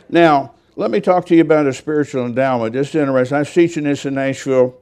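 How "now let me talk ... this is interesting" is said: usual speaking voice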